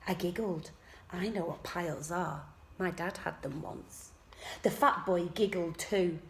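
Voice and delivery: usual speaking voice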